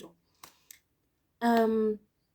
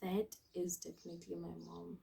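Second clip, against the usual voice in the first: much softer, lower murmur; quiet dread; 4 semitones lower, 15 dB quieter than usual. Retold or imitated. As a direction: say it under your breath in dread.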